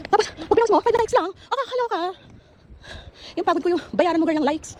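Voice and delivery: high, loud diva rant about five likes; dramatic complaint, high and loud; sassy rant. Real, not acted. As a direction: gripe theatrically, like a put-upon diva. Ranting about few likes.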